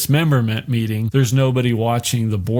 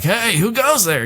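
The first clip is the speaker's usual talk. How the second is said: mock sentry challenge; 10 semitones higher than usual. Lookouts challenging arrivals.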